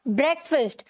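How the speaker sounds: ब्रेकफास्ट as "BREK-fust"; short e and reduced second vowel in ब्रेकफास्ट; correct pronunciation. Shown as a demonstration. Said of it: "क्या बोला जाएगा?".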